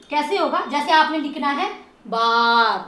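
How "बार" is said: बार with a long, level "aa". Shown as a demonstration. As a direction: stretch the vowel in बार. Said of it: "long sound आ रहा है". (बार में "आ" लंबा खींचें)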